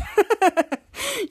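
high, put-on chuckle; imitated chuckle. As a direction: act out a short teasing chuckle. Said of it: "Ray chuckles".

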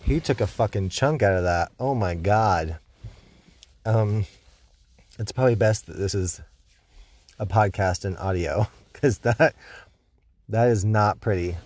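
speaking with a numb tongue; numb-tongue speech after dental work. Real, not acted. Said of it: "half of my tongue is numb".

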